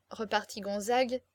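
plain reading voice

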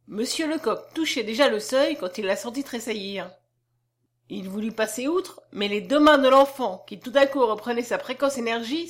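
ordinary delivery

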